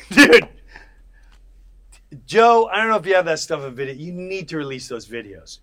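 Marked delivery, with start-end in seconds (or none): 0.1-3.9 loud, fast, laughing plea to Joe; excited plea. Real, not acted. Begging Joe to release his old videos, excited and laughing. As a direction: burst out begging Joe to share it.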